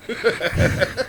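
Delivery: short, high, soft laugh; real laugh. Real, not acted. Storyteller laughing after slipping up on his buddy's name, amused. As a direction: let out a brief, light laugh.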